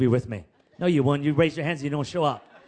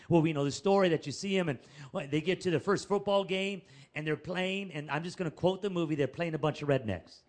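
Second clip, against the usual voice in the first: usual speaking voice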